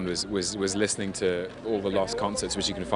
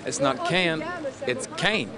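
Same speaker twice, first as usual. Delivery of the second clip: higher Southern coach voice, correcting; 7 semitones higher than usual. Retold or imitated. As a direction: play the vocal coach setting him straight. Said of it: "And [name] would say".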